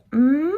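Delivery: "Mm" hummed through the nose; nasal m. Shown as a demonstration. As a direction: hold "Mm" with lips together. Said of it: "those are the nasal sounds".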